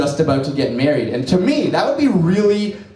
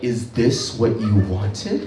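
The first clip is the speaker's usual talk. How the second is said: eerie slow whisper; 6 semitones lower, 3 dB quieter, slower than usual. Retold or imitated. Girlfriend's eerie line.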